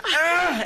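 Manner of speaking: high, strained swinging "ah"; mimed effort groan. Retold or imitated. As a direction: grunt as if pumping a swing with your legs. Mimics pumping legs on the swing.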